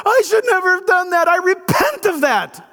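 shouted mock apology. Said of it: "I can say".

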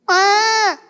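high bleating whine; whining sheep. Retold or imitated. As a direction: forlorn sheep left behind.

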